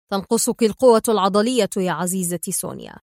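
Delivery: ordinary delivery, nothing marked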